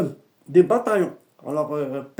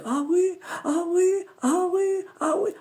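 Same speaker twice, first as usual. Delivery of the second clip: high, whimpering djinn voice; 12 semitones higher than usual. Retold or imitated. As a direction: answer meekly, high up. (le djinn répond d'une voix aiguë et plaintive)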